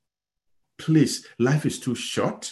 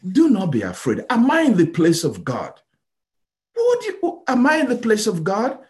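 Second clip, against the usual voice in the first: pleading Joseph; 9 semitones higher, 5 dB louder than usual. Acted. Joseph speaks to his brothers.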